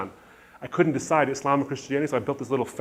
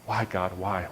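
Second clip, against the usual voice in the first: low, subdued retold plea; softer, lower self-questioning; muttering to himself; 5 semitones lower, 7 dB quieter, slower than usual. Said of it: "muttering to myself".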